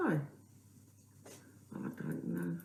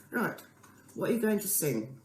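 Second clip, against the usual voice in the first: normal talking voice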